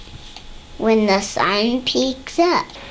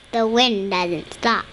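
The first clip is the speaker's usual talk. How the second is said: ordinary delivery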